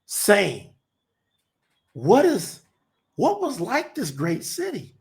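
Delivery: measured, solemn reading of scripture. Acted. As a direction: read the prophecy slowly and gravely. Reads Revelation aloud to audience.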